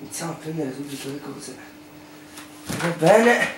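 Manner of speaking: normal talking voice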